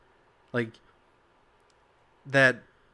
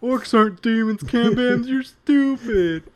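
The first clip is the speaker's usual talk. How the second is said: much higher, louder, slow taunting voice; mock silly voice; 10 semitones higher, 6 dB louder than usual. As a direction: tease him in a goofy high voice. Mocking his friend in a silly voice.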